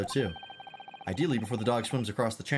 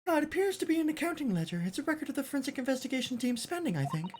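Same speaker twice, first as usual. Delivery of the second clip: put-on much higher, polite young assistant; high girlish assistant voice; 14 semitones higher than usual. Assistant hoping the scent leads to the swindler, high and polite. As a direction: lift into a prim young lady's voice.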